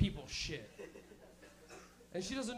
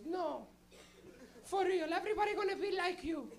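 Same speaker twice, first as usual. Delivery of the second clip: high Portuguese-accented mother impression; imitated Portuguese mom, pitched way up; little Portuguese lady; 9 semitones higher than usual. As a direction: insist like an earnest Portuguese mom. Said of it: "she's a little Portuguese lady".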